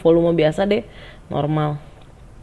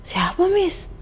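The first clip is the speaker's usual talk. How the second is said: higher-pitched startled question; bertanya kaget; 13 semitones higher, slower than usual. Retold or imitated. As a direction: ask it high, taken aback. Reaksi kaget mendengar kabar. (bertanya kaget dengan nada tinggi)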